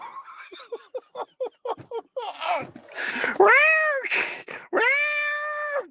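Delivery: high laugh breaking into loud mock meows; laughing, then drawn-out meowing; laugh into cat meows. Real, not acted. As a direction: crack up, then yowl like a cat. Joking about cat name Kitty.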